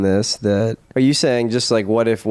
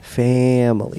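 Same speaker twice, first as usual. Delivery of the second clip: mobster voice; slower than usual. Acted. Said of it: "say with me".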